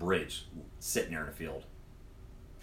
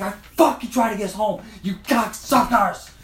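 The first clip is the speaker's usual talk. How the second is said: Clark Griswold scream; 11 semitones higher, 8 dB louder than usual. Retold or imitated. Driver slamming the brakes to scream at the passengers, furious. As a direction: blow up like a dad at Wally World. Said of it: "screamed at him like I was Clark Griswold".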